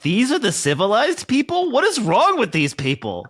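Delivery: high, incredulous comic outrage; appalled disbelief. Retold or imitated. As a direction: question it in mock horror. Acted reaction to watching bake show.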